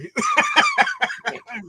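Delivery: high laugh at the story; burst of laughter. Real, not acted. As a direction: laugh out at the punchline. Laughing at the missed moment.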